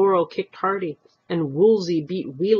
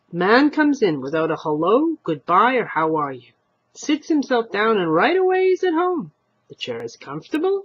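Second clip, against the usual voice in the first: put-on much higher, louder scolding old wife; higher and louder, indignant old lady; indignant old woman; 6 semitones higher, 6 dB louder than usual. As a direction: scold the rude guest.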